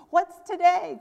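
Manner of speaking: loud, high call down to the street. A narrator's reading. Scrooge calling down from his window to the boy, loud and high. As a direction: shout down from the window. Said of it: "cried Scrooge, calling downward to the boy".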